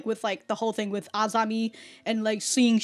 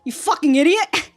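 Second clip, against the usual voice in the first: exasperated scolding; 8 semitones higher, 7 dB louder than usual. Real, not acted. Scolding character on screen.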